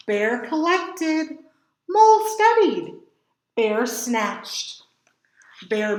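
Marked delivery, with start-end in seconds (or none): 1.9-3.0 higher, louder small-creature voice; a busy little mole; Mole's voice. A narrator's reading. Character voice for Mole.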